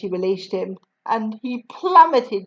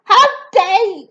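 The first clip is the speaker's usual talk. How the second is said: higher, louder outraged shout; 9 semitones higher, 5 dB louder than usual. Acted. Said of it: "shouted the Trunchbull".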